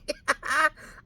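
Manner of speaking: high burst of laughter; loud laugh. Real, not acted. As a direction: let a big laugh out. Laughing at the memory.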